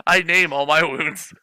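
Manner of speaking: ordinary talk, nothing marked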